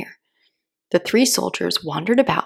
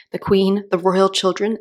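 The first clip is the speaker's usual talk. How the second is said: usual speaking voice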